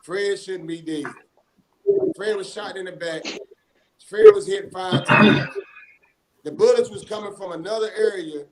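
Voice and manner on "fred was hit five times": slow, much higher, much louder outcry; anguished shout. Real, not acted. Relative on air crying out how Fred was shot, slow anguished shout. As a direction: shout each word out slowly in anguish. Outrage over the shooting.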